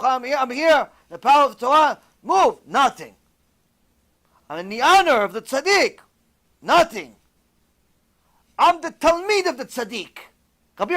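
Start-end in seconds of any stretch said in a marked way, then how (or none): 0.0-2.7 high, loud command to move; commanding shout. Acted. Rabbi orders the anaconda blocking the cave, loud command. 4.5-6.0 loud, high proclamation; forceful declaration. Acted. Rabbi tells the anaconda whose honor he comes in, forceful. 8.6-10.2 loud, high proclamation; forceful declaration. Acted. Rabbi tells the anaconda whose honor he comes in, forceful.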